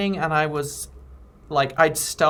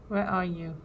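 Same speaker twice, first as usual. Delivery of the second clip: ordinary talk, nothing marked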